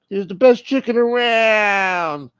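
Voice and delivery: high, loud, stretched-out mock cry; exaggerated high-pitched repeat; high drawn-out holler. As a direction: draw it out high in a put-on voice. Repeats phrase in put-on holler.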